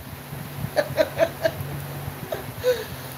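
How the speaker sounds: soft laugh through tears; teary breathy laugh. Real, not acted. Mother remembering her daughter leaving, laughing through tears. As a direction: laugh while close to crying. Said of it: "laughing and crying".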